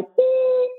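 high, drawn-out imitated beep; machine beep. Reader imitating the answering machine tone. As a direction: sound the tone of the machine.

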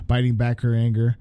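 plain reading voice

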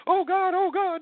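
very high-pitched, hammed-up panic; mock fear. Mocking someone scared by a recorded voice, exaggerated high panic. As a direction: squeal it like a frightened amateur. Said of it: "If you're afraid".

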